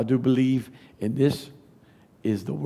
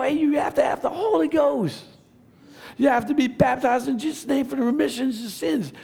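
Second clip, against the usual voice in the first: preaching; 7 semitones higher than usual. Acted. Preacher exhorting the church to be soul winners, full-force preaching. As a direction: drive it home to the congregation.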